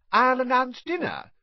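a high, fussy housewife; Aunt Petunia voice. A narrator's reading.

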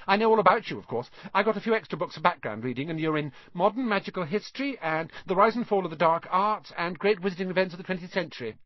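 high, fast know-it-all schoolgirl; bossy girl. A narrator's reading. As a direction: rattle off facts officiously. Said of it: "She had a bossy sort of voice".

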